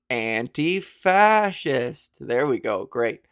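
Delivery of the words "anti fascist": "Anti-fascist" drawn out syllable by syllable, slow and louder; "Anti-fascist" stretched, each part clear; slow spelled-out demo. Shown as a demonstration. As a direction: say "Anti-fascist" slowly like teaching a class. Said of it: "Say it with me".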